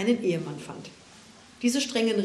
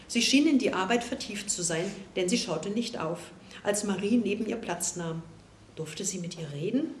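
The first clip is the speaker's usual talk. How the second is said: ordinary delivery, nothing marked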